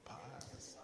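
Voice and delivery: slow, soft contented murmur; sighing peace. Retold or imitated. Imitating a worshipper sighing in peace. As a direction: breathe out the words in bliss. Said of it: "así como".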